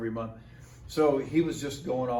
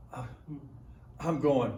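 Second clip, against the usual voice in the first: ordinary talk, nothing marked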